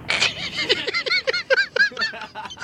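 high breathy giggle. Pretend. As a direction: dissolve into giggles. Pilot giggle.

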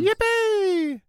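high squealed name; excited cheer. Pretend. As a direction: cry the name out with glee.

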